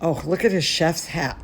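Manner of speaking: ordinary delivery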